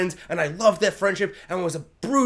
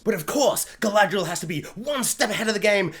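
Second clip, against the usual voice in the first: ordinary delivery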